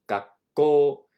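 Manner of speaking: 学校 with a hard, harsh catch on the double K; harsher. Shown as a demonstration. Teacher showing students the harsh double consonant, pron. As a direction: bite down on the stop in 学校. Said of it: "It's a little more harsher".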